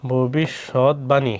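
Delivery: "ভবিষ্যৎবাণী" very slow, in parts: bho-bish-shot-bani; syllable by syllable. Shown as a demonstration. Teacher sounding out the word, "ভবিষ্যৎবাণী" slowly syllable by syllable. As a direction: stretch and separate each part of "ভবিষ্যৎবাণী". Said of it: "এটা হবে কি?".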